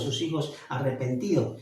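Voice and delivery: ordinary talk, nothing marked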